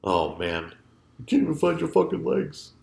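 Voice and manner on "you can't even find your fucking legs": higher, lightly voiced Stallone; a lighter replay of the punchy quote; faint echo of the Rocky voice; Stallone line again. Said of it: "sounded like Rocky".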